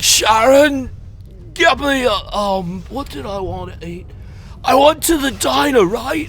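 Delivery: put-on loud, high, slurred drunken rambling. Comic imitates drunk friends calling for [name], mock drunken ramble. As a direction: shout out, then ramble on in hesitant bursts. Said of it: "they talk like [name]".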